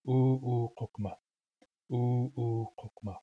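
U'ukokma slow, with two held U sounds then quick kokma; long-long-short-short word rhythm. Shown as a demonstration. Teacher putting sounds back in, long-long-short-short. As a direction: stretch both vowels at the start of U'ukokma. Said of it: "when I say the rhythm".

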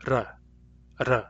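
r as a quick tongue tap, like a fast d; flap sound. Shown as a demonstration. Teacher showing students the flap sound. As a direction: tap the r once, lightly and fast. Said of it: "pronounced as a flap".

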